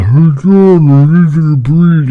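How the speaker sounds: mock garbled voice from far away; loud, slow, blurred mock-underwater call; distant muffled voice. Shown as a demonstration. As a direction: smear the words as if heard through a haze. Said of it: "it's not like a".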